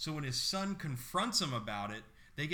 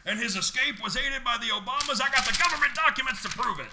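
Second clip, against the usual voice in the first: higher, louder, ranting conspiracy-nut voice; mock conspiracy theorist; 11 semitones higher, 9 dB louder, faster than usual. Ranting to a cohost about the Obamas, mock conspiracy nut. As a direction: rant it fast like a crank with documents. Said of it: "[name], put it away".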